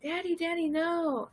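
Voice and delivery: high, restrained mock yell; retold yell. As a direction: re-enact a desperate call without full volume. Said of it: "I was yelling".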